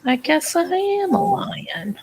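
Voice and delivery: slow, high, breathy whisper; playful whisper. Pretend. Playful reply about the lion.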